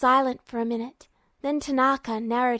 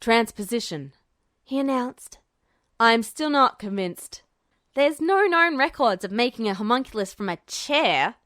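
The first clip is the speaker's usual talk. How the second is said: lightly voiced general. General unconvinced.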